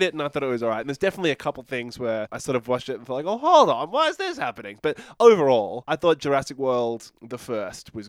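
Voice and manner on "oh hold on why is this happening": high-pitched, puzzled objection; retold double take, voice jumping up; mock bewilderment. Retelling confusion while watching a film, mock bewildered.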